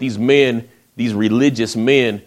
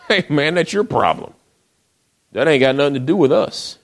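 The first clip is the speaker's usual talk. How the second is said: higher-pitched mock brush-off; acted "not our problem" dismissal; dismissive retort; 5 semitones higher than usual. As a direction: shrug off someone else's trouble in a raised voice. Priests acted brushing Judas off.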